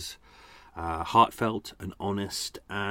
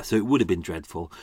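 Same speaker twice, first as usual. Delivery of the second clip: normal talking voice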